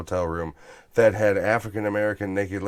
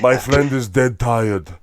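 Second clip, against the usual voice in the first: put-on slow, louder Austrian-accented Arnold line; deadpan it like Arnold in Commando; Schwarzenegger impression; 6 dB louder, slower than usual. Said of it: "he's like".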